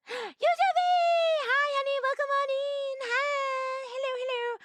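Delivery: light up at a favourite viewer; excited greeting. Real, not acted. Streamer greeting a regular viewer, thrilled and high.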